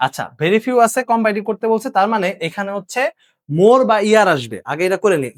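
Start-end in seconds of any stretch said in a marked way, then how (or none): none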